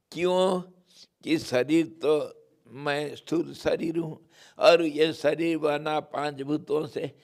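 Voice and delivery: ordinary talk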